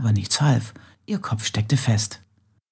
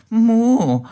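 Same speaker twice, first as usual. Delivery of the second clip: loud, much higher cow's moo with attitude; eye-rolling moo; 12 semitones higher, 7 dB louder than usual. Acted. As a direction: moo back like a cow rolling her eyes. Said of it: "verdrehte die Augen". (genervtes, lautes, hohes Muhen)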